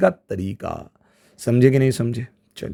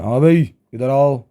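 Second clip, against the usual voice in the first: stern summons. Shown as a demonstration. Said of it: "अब तोड़ाई लगने वाली है".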